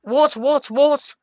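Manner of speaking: lightly voiced Swedish chef accent; a quick Muppet Swedish chef accent. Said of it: "in the Swedish chef accent?".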